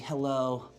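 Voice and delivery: ordinary talk, nothing marked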